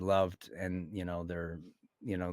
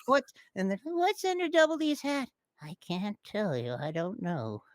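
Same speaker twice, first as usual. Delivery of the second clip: cartoon voice; 12 semitones higher, 4 dB louder than usual. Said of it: "acting out character exchange".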